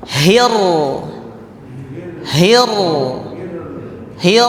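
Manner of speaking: "hir" with a held, stressed "r"; doubled r. Shown as a demonstration. Teacher drilling the doubled ra in "hir".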